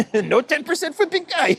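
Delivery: high, laughing mock Ukrainian accent; mock Zelensky voice. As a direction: squeal it like a caricatured Zelensky.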